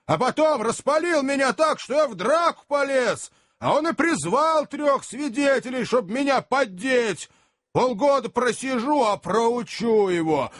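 high-pitched aggrieved cabman's rant; heated tirade. Acted. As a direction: complain hotly to the crowd, pitched high. Cabman's tirade before a fight. (запальчивая возмущённая тирада)

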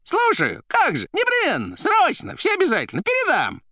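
loud, very high abrupt bursts; staccato. A narrator's reading. The receiver promising to pass it on, staccato. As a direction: fire off each word separately, squeaky. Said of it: "отрывисто стукала трубка".

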